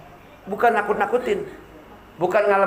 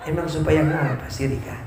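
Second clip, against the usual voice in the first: curious husband; 6 semitones lower, 3 dB quieter than usual. Acted. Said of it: "Pasti ngomongnya begini lagi".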